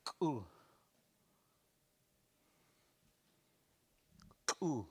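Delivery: "Ku" with a sharp burst of K; exploding K. Shown as a demonstration. Teacher repeating the word for give, exploding K. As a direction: pop the K hard in "Ku". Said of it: "It's kinda like exploding K".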